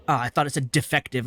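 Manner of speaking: ordinary delivery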